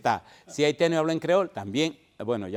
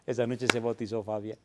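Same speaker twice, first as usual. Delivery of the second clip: usual speaking voice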